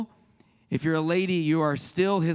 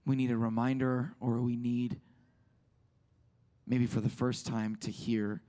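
prayer; 7 semitones lower, 6 dB quieter than usual. Acted. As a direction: pray quietly, unhurried. Leads a prayer.

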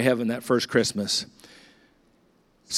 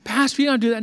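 higher voice for the churchwoman, protesting kindly; lady's surprised protest; 8 semitones higher, faster than usual. Retold or imitated. Pastor voices the lady refusing his offer, higher and protesting. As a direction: lift the voice to play the lady insisting he needn't bother.

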